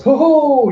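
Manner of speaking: high, loud swooping chuckle; theatrical villain ho-ho; clownish ho-ho. Acted. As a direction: hoot like a gloating clown.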